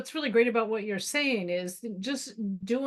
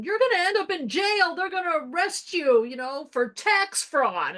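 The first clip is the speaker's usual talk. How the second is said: much higher, alarmed warning; mock panic; 7 semitones higher, 4 dB louder than usual. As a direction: scare him with doom. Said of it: "call him going".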